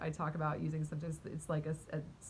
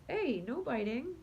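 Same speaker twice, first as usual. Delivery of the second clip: mild scolding; 5 semitones higher than usual. Real, not acted. Scolding the cat mid-story.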